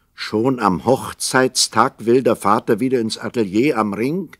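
ordinary talk, nothing marked

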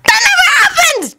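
very high shrieked denial; panicked high-pitched outburst; flustered denial. A narrator's reading. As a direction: deny it in a high squeal. Naruto flustered, denies the kiss.

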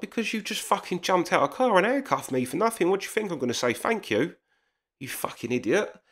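high-pitched, heated comeback to the officer; retelling an angry shout at the cop, voice pitched high; irate retort. Retold or imitated. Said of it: "Why are you getting so high a rate".

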